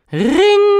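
high, loud "riiiing" held very long. A narrator's reading. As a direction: hold the doorbell's ring on one piercing note. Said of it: "klonk nog dwingender dan de eerste keer". (dwingend, lang aangehouden hoog belgeluid)

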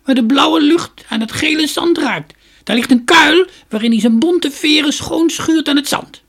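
put-on high, loud, creaky old woman's voice; shrill-pitched storybook crone, full voice. A narrator's reading. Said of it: "riep een krakerige stem".

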